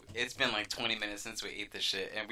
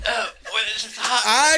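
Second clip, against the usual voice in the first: shocked high yelp; 11 semitones higher, 11 dB louder than usual. Real, not acted. Reacting to extreme spicy food.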